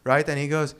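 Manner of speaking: normal talking voice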